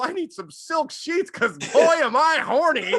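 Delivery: high, loud, giddy husband; excited husband voice. Acted. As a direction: squeal it like a worked-up husband. Acting a horny husband.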